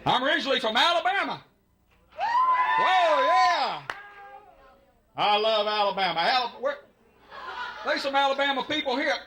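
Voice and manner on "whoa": a shouted whoa that leaps way up; high excited whoa. Real, not acted. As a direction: jump on the cheer with a big yelp. Alabama crowd cheers loudly.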